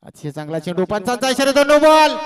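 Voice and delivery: commentator erupting at the umpire's signal; building no-ball call. Real, not acted.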